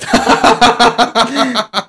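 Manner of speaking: high-pitched burst of laughter; real amused belly laugh; hearty laugh. Real, not acted. Laughs at the guest's remark.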